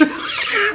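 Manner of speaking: quick high laugh; short laugh. Real, not acted. Laughing at the story.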